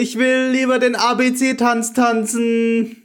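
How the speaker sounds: put-on high, slow whiny kid; childish pleading, drawn out. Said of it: "playing a child".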